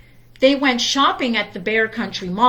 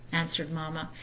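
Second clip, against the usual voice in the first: usual speaking voice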